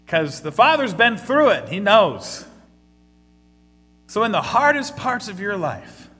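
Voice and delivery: loud, high, fervent preaching; preaching at full force. Real, not acted. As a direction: drive the point home to the congregation.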